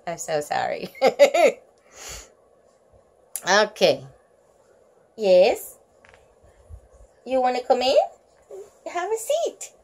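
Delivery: ordinary delivery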